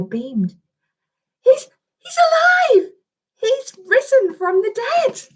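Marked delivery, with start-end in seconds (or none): 1.4-5.2 high, overjoyed shout; joyful shout. Acted. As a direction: burst out with joy. Angel overjoyed Jesus risen.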